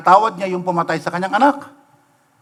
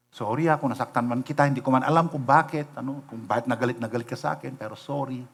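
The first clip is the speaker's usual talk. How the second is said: soft humble apology; 4 semitones lower, 7 dB quieter than usual. Retold or imitated.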